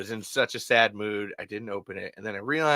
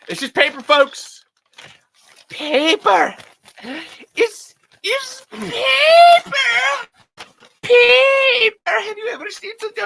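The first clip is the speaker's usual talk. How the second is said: shrieked, much higher mock-sobbing "paper"; Waterworld paper scene; 9 dB louder, slower than usual. Host hamming up the Waterworld paper scene for nineties kids, high sobbing shriek. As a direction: wail "paper" like the Waterworld scene, crying and yelling. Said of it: "That was for all the nineties kids".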